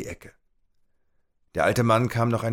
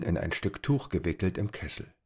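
usual speaking voice

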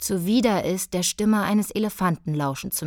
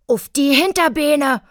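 loud high shriek; 8 semitones higher, 7 dB louder than usual. A narrator's reading. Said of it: "kreischte Billy".

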